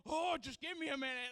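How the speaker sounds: high strained plea for a moment; hurting, catching his breath; winded. Retold or imitated. Pastor on the floor, winded and strained. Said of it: "he said".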